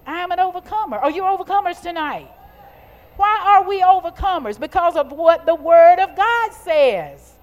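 high, loud rallying cry; full-force preaching. Real, not acted. Preacher asking if they are overcomers tonight, rousing preaching. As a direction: rouse the congregation, loud and high.

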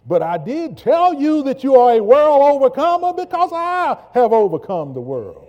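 full-voice preacher's shout; roaring climax. Acted. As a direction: belt it out as the peak of the sermon. Preaching climax quoting Jesus.

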